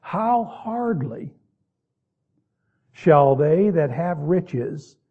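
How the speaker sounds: slow weighty emphasis on How hardly; key words stressed. Shown as a demonstration. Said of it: "here's the two key words".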